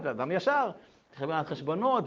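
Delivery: normal talking voice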